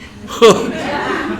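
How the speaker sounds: high, breathy burst of real laughter. Real, not acted. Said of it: "reacts to Terry's remark".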